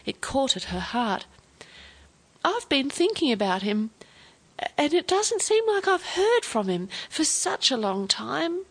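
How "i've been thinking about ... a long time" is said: put-on high, plaintive old man close to tears; broken voice. A narrator's reading. Tearful grandfather tells Olivia about his missing father, broken voice. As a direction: pitch lifting into a fragile plea. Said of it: "voice sounded like something had broken".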